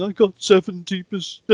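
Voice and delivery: high-pitched imitation of a tearful wail; mock tearful student. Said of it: "coming in shaking and in tears".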